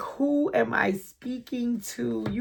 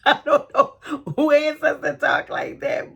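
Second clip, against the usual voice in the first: amused disbelief; 6 semitones higher, 6 dB louder than usual. Real, not acted. Laughing amazed at the ancestors.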